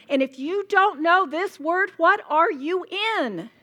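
loud, high preacher's demand; forceful challenge. Real, not acted. Preacher pressing women on knowing the word, loud urgent challenge. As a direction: call out to the crowd for an answer.

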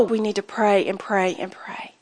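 breathy whispered plea. Real, not acted. Speaker urging the women to keep praying, whispered. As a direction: lean in and whisper it.

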